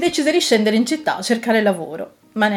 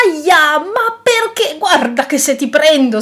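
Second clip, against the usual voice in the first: high, loud yelp of protest; angry outburst; 8 semitones higher, 6 dB louder, slower than usual. A narrator's reading. Dragon pelted with pine cones by squirrel, outraged. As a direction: burst out, pained and threatening. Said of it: "sbottò il drago".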